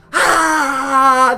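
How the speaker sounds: imitated crowd cheering roar. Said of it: "the crowd goes wild!".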